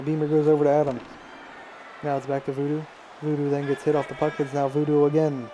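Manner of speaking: steady running hockey call, picking up on shots; sportscaster calling the puck; play-by-play commentary. Acted. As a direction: call the game like a TV announcer.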